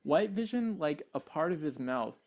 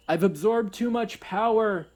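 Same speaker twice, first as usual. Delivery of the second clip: loud, high-pitched overblown villain lament; mock melodrama; 9 semitones higher, 8 dB louder than usual. Mocking the cliché fight ending, loud melodramatic cry. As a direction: declaim it as a hammy cliché.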